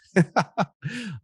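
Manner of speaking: light amused laugh; breezy laugh off the attack; husband laughs off the complaint, amused. Shown as a demonstration. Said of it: "I just".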